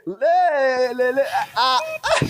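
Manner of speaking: a podcast host acting out her shock at the series accent, hammed-up cries; mock dismayed cries. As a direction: cry out in mock horror at the screen.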